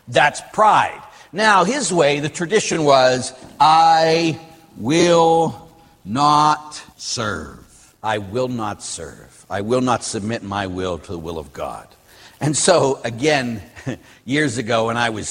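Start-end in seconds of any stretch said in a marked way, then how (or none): 3.6-7.6 each word of the refusal stretched far out; drawn-out defiance. Acted. Quoting Satan's defiant refusal, word by word. 8.0-9.4 low, soft and slow refusal; hushed defiance. Acted. Same refusal repeated in a lowered voice. 9.5-11.9 low, soft statement of refusal; hushed defiance. Acted. Refusal continued in lowered voice.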